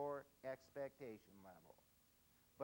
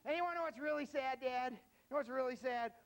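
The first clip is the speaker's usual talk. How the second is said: mocking teen; 9 semitones higher, 8 dB louder than usual. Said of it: "He says".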